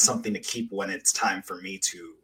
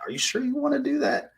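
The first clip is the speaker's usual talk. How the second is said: much higher, fast mock colleague asking; a colleague questioning her teaching method, in a high mock voice; sceptical colleague; faster than usual. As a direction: squeak out a doubting colleague's question. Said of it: "that were just like".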